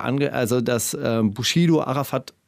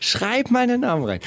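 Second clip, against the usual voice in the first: higher mocking officer voice; mock policewoman; 8 semitones higher than usual. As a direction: ape the cop giving a sly order. Polizistin Kühn nachgeäfft. (Kommissarin Kühn nachgeäfft, höher und spöttisch)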